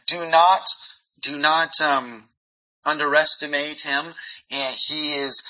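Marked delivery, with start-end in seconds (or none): none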